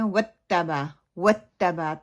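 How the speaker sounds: وَتَّبَا with a hard double t, "watt-taba"; clipped "wat-taba" with shadda; wrongly doubled t. Shown as a demonstration. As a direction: mimic the mistake, stopping on the t in وَتَّبَا. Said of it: "تا کے اوپر شدہ لگا دیتے ہیں".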